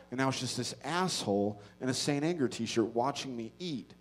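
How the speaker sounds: usual speaking voice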